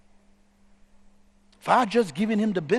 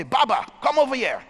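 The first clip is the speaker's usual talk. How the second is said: raised, higher call; 6 semitones higher, 4 dB louder than usual. Retold or imitated. Storyteller summons Baba to the food truck, calling out. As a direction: call someone over.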